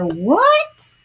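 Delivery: high rising what; incredulous. Retold or imitated. Alex hearing who likes pink, incredulous. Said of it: "And he said".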